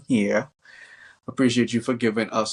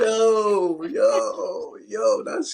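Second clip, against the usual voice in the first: excited; 11 semitones higher, much slower than usual. Real, not acted. Host amazed by the story.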